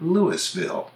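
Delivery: LOUISVILLE as LOO-is-vil; Ohio pronunciation. Shown as a demonstration. Naming the town in Ohio, Louisville with the S sounded. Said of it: "spells its name exactly the same".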